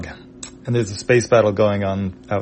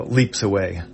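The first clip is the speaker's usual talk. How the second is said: usual speaking voice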